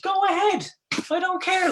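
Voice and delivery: loud, high, fed-up go ahead; high exasperated shout; exasperated outburst. Real, not acted. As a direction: throw the words out, exasperated. Heated rant about freedom.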